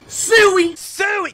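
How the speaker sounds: high, loud mock Siuuu cry; Ronaldo celebration shout. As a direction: belt out Suey like Ronaldo scoring.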